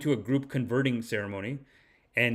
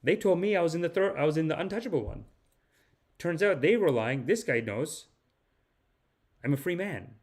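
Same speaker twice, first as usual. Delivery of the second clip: higher, lightly tearful retelling; mild mock tearfulness; 5 semitones higher than usual. Untouchable man learns he is equal, mildly tearful imitation. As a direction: imitate a man moved to tears, understated. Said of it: "he's crying".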